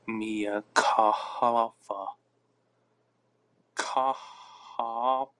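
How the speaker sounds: "Khalifa" sounded out very slowly in pieces; struggling pronunciation. Real, not acted. Trying a name they can't say, struggling pronunciation. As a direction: drag out "Khalifa" syllable by syllable, unsure of it. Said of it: "Can't even say that".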